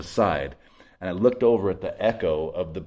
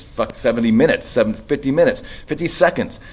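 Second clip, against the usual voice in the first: rising exasperation; 5 semitones higher, 5 dB louder than usual. Retold or imitated. Exasperated countdown in despair.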